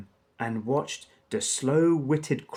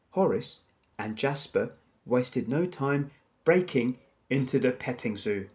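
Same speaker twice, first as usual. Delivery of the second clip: usual speaking voice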